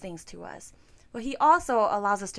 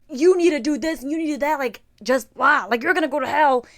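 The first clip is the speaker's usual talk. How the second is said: fast, louder scolding voice; mock harsh God; 3 semitones higher, 6 dB louder than usual. Imagined strict God condemning her, fast and louder. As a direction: rattle off demands like a strict judge. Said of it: "He wasn't like".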